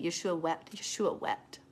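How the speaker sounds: ordinary talk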